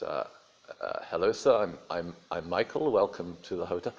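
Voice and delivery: quiet, hesitant, stammering reply; lightly voiced timid hotel waiter; nervous waiter. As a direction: mumble back like a flustered waiter. Timid waiter Michael replies.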